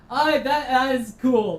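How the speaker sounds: excited. Real, not acted. Delighted after the scare.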